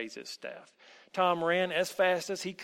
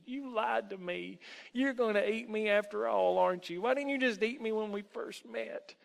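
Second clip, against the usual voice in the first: frightened, higher trembling voice; shaky with fear; 7 semitones higher than usual. A narrator's reading. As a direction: plead with a scared boy's fear. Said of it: "In a voice shaky with fear".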